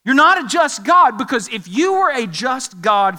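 acted indignant accusation; loud accusation. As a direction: fire the charge at God forcefully. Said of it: "That's an accusation".

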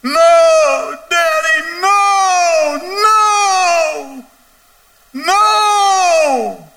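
anguished high screamed plea; wailing. Acted. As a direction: cry out like a desperate child, long and loud. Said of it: "it would be something like this".

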